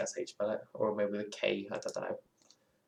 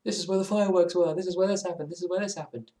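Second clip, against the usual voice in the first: much higher, giddy fan gushing; fan in awe; 8 semitones higher than usual. Retold or imitated. Fan visiting Frodo and Bilbo's house, higher giddy awe. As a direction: gush like a Tolkien fan at Hobbiton. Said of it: "you're like".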